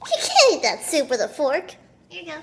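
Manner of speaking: ordinary talk, nothing marked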